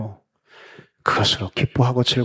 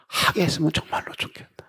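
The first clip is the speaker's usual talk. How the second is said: breathy heartfelt whisper; whispered wish; 5 semitones higher, 7 dB quieter than usual. Real, not acted. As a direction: let the wish fall into a whisper. (속삭이듯 간절한 바람)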